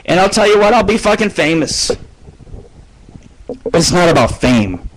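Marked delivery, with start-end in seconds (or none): 0.0-1.9 mock grand boast. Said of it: "dreaming of fame".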